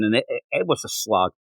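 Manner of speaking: normal talking voice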